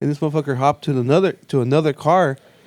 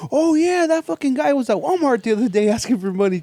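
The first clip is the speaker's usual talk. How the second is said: excited; 9 semitones higher than usual. Retold or imitated. Buddy quoted, excited.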